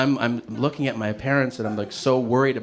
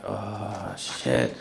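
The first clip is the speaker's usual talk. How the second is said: much lower and softer, under the breath; low muttered oh shit; 4 semitones lower, 6 dB quieter than usual. Retold or imitated. Patient quietly grasping the overdose, low mutter. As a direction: mutter it to yourself, eyes closed. Eyes closed processing.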